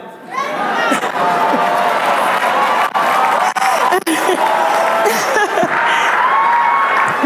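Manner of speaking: hearty high-pitched laughing fit; big laugh. Real, not acted. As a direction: let a real laugh break out. Laughing at her own story.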